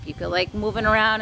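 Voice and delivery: ordinary talk, nothing marked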